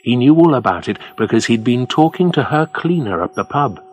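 ordinary delivery

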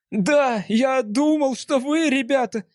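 put-on high, breathless boyish voice; Jimmy voice. A narrator's reading. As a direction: speak up high like a flustered lad. Jimmy's voice held in story.

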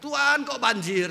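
high, loud, drawn-out protest; hammed-up shout of grievance; mock yelled complaint. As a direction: cry out slowly to God in mock dismay. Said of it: "kita teriak".

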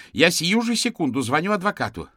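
very high, loud threat; furious high-pitched yelling; outraged yelling mother. A narrator's reading. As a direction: shriek it as an indignant mother. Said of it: "завопила она".

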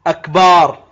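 "أكبار" firm, with a long held "baar"; lengthened ba. Shown as a demonstration. Teacher naming the word for a big drum, lengthened ba. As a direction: stretch the aa after the b in "أكبار". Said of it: "أكبار بفتحها".

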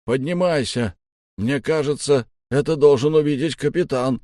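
high, urging call; calling from above. A narrator's reading. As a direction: call down to a crewmate. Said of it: "ответили сверху".

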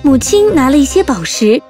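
ordinary delivery, nothing marked

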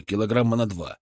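ordinary delivery, nothing marked

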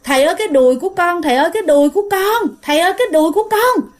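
high, fast, rising pleas that climb each time; a panicked girl calling the monk again and again; retold frantic cry. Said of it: "nghe cái tiếng la".

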